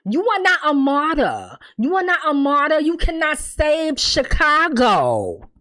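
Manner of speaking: ordinary talk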